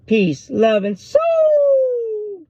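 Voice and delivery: high, hugely stretched show catchphrase; Don Cornelius. Retold or imitated. Host signing off Soul Train, long high-held catchphrase. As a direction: belt out a TV sign-off, holding the last word. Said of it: "with Don Cornelius".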